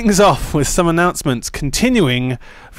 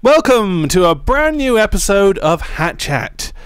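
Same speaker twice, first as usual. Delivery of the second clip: announcer intro; 6 semitones higher, 3 dB louder, slower than usual. Acted. Podcast intro.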